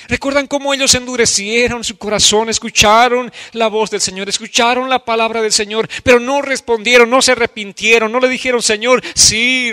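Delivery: high, loud impassioned preacher's cry; a pastor crying out a prayer of repentance before the church; fervent preaching. Acted. Preaching at full force.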